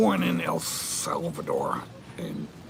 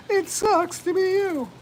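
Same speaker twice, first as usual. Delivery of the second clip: teasing; 10 semitones higher, slower than usual. Real, not acted. Teasing repeat.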